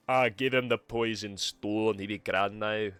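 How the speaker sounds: a Scottish lilt that was meant to be Irish; Scottish accent. Shown as a demonstration. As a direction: try for Irish, land in Scottish. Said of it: "Oh, wait, that's Scottish".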